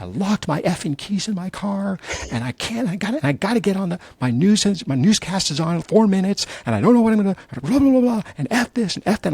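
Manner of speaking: high, frantic, fired-up rant; cursing like a sailor. Retold or imitated. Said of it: "she is cursing like a sailor".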